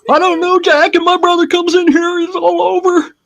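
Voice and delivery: squeaky high Furley voice; Mister Furley doing Don Knotts, high; Mister Furley impression. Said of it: "I gotta get higher".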